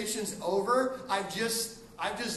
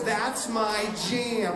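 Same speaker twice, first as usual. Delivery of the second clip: slow demo; 5 dB louder, much slower than usual. Shown as a demonstration. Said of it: "all you know is".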